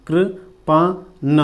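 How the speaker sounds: Kirpan drawn out very slowly; slow syllable by syllable Kirpan; slow dictation. Shown as a demonstration. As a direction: stretch Kirpan as you write it. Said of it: "ठीक है, कृपान".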